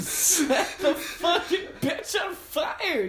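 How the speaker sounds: high, slow laughing shout. Real, not acted. Gamer urging friend to burn the monster, laughing shout. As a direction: cackle it out, egging them on.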